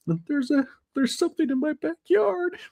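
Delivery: very high-pitched joking scared voice; mock petrified. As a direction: squeak it out as a hammed-up frightened caller. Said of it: "like, petrified".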